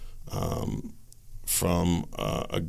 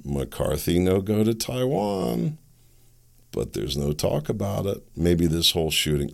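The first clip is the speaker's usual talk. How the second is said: ordinary delivery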